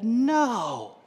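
put-on high, drawn-out child's denial; imitated four-year-old. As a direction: stretch it high like a little kid fibbing. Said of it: "my four-year-old said".

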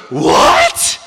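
loud mock-shocked cry; Haman's shocked outburst. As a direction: blurt it loudly as an aghast question. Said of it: "And Haman goes".

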